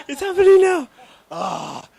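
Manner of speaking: choked, emotional laugh as tears start; real tears rising. Real, not acted. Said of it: "I can start crying".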